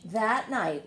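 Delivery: ordinary delivery, nothing marked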